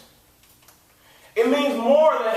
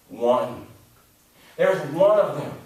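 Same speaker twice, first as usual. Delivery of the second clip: usual speaking voice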